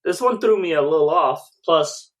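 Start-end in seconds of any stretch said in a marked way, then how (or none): none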